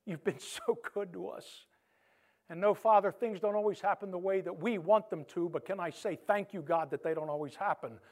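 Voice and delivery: earnest pastoral prayer, slowed on key words; closing prayer. Acted. Pastor praying over the congregation, earnest and fervent. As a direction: pray for the congregation with feeling.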